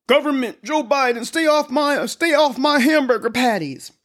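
high-pitched, hammed-up indignant congresswoman; mocking Lauren Boebert. Host mimicking congresswoman warning government off her hamburgers, mock indignation. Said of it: "that was Lauren who had that to say".

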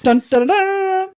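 high, loud staccato chant. Acted. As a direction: punch out each beat. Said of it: "I need a little more staccato in your voice".

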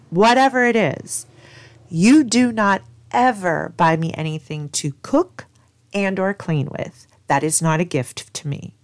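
firm retold warning, stressed phrase by phrase; no uncertain terms. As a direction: spell out the rule forcefully. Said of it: "in no uncertain terms".

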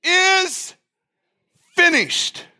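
high ringing cry, drawn out word by word; loud cry. Retold or imitated. Preacher acting out Jesus on the cross, crying out loud. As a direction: cry it out with long pauses between words. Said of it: "cried out with a loud voice".

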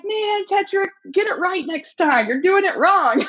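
high, drawn-out jeering shout; mock taunt building to a shout; imitated heckling. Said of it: "You know, we're heckling".

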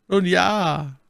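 anger hidden behind a smile; Cardinal, smiling. A narrator's reading. Cardinal smiles at the musketeers, hiding his anger. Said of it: "sein Ingrimm in ein Lächeln verwandelt".

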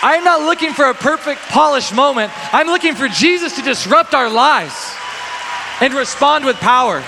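loud, high altar call; rally the crowd forward; full-force preaching. Acted.

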